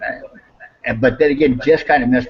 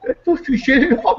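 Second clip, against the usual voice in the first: high, laughing through That don't do shit at all; amused retelling; laughing repeat; 12 semitones higher than usual. Retold or imitated. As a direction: chuckle as you say it again.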